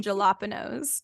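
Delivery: jalapenos as "juh-LOP-uh-nose"; grandfather's mispronunciation. Retold or imitated. Imitating a grandfather naming peppers, his anglicized mispronunciation. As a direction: say "jalapenos" with a hard English J, stressing LOP. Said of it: "My grandfather called them".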